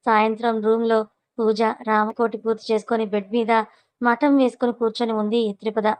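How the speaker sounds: ordinary delivery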